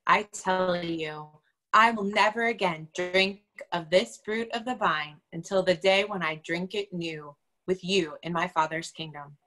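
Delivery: ordinary talk, nothing marked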